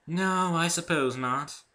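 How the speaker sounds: regretful tone. A narrator's reading. Rarity answering Dave in a regretful tone. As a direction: let the words sag with disappointment. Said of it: "in a regretful tone".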